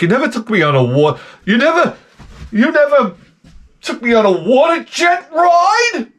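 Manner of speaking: hammed-up high complaining girlfriend; nagging girlfriend. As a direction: whine it like a scorned girlfriend.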